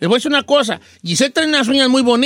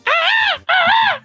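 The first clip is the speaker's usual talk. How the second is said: high falsetto cumbia yell; [name] yell. Shown as a demonstration. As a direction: let out the [name] cry. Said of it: "le sale el grito de los [name]".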